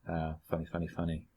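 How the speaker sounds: retold laughter running out, softly; waning amusement. Retelling a comedian tiring of a road-sign joke, waning amusement. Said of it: "it's not getting that funny anymore".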